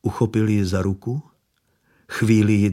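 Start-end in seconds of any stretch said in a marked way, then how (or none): none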